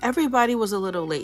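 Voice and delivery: usual speaking voice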